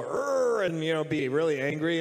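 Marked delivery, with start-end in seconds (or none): 0.0-0.6 growl. Retold or imitated. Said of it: "he's supposed to go".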